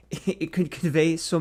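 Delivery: ordinary talk, nothing marked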